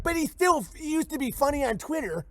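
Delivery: very high, pleading whine; whiny excuse. Retold or imitated. Streamer mocking a Trump fan's excuses in a very high whine. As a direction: whine it like a clueless fan making excuses. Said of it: "if I was to be like".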